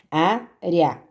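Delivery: "Área" said apart with a strong "Á" and the ending "rea" joined; careful word demo. Shown as a demonstration. Teacher to students, showing the diphthong in a word, deliberate. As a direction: pronounce "Área" slowly as one stressed "Á" plus joined "rea". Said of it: "na palavra área".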